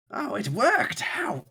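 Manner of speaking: high, loud delighted exclamation; surprised NPC. Acted. Scientist surprised the call worked, excited exclamation. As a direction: gasp with sudden delight.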